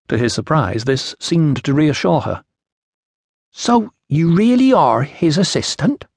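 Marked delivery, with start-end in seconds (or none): 3.5-6.1 put-on slow, higher-pitched voice in an Iceni accent; foreign Iceni accent. A narrator's reading. Iceni woman questions the young assistant procurator, foreign accent. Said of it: "trouble following your accent".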